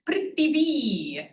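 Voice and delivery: "prithivi" with a long, stressed final "vi"; stress at end. Shown as a demonstration. Teacher showing long syllables, end-stressed "prithivi". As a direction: slowly lean on the last "vi" of "prithivi". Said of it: "emphasis again goes on the long syllable".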